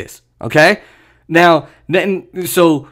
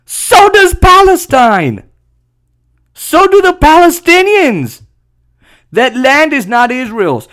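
high, loud, drawn-out yell; outraged shout; 9 semitones higher, 4 dB louder than usual. Real, not acted. Commentator rebutting the defend-themselves argument, outraged shout. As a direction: shout the retort at a very high pitch, stretching it. Furious retort to quoted argument.

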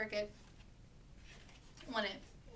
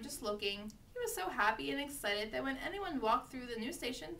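ordinary delivery

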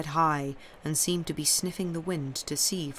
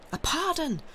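high-pitched exclamation; crowd's cry; 12 semitones higher than usual. A narrator's reading. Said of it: "the crowd cried in unison".

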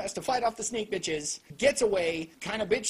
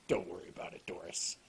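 much lower and softer, dead flat delivery; mock the bland stoic hero line; 9 dB quieter, faster than usual. Reviewer mocking D's bland line, low and flat. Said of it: "He's like".

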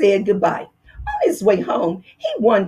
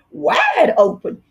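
yelling; 7 semitones higher, slower than usual. Acted. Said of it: "yelled his wife".

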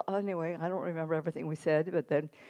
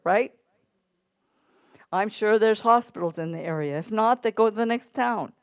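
raised, slowed-down pulpit exhortation; preaching; 5 semitones higher, 7 dB louder than usual. Acted. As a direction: press the point home to the church.

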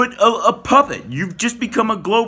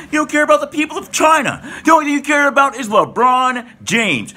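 angry shout; 7 semitones higher than usual. Real, not acted. Angry rant at LeBron.

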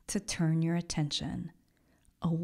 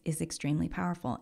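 ordinary talk, nothing marked

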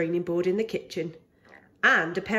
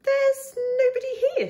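much higher, squeaky little bear asking; Baby Bear voice; 3 dB louder than usual. Acted. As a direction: ask like an eager tiny cub.

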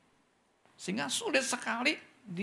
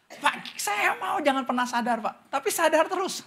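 mimicking a confused churchgoer; 9 semitones higher than usual. Meniru jemaat yang bingung.

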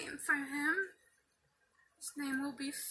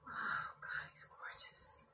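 hushed, voiceless wonder; awed whisper; 10 dB quieter than usual. Real, not acted. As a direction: whisper it in sheer awe. Awed at unboxed doll.

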